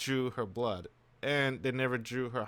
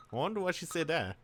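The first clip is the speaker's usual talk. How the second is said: usual speaking voice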